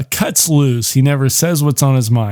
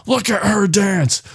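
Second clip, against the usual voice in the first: mock angry demand; 7 semitones higher than usual. Patrick grabs the boy's face.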